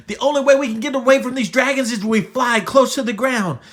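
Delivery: fast, high, loud instructions; urgent planning. Acted. Buttercup telling Yodi the escape plan, urgent rush. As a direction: rattle it out urgently.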